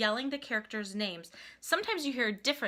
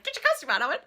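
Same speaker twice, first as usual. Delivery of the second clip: high, louder mock-urgent order; retold hurried ordering; 5 semitones higher, 5 dB louder, much faster than usual. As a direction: act out a flustered mom passing on an order. Mother obeys, orders her son.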